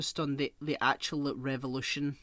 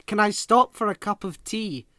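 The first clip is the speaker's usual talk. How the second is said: mocking employee; 5 semitones higher, 9 dB louder than usual. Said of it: "he told this person".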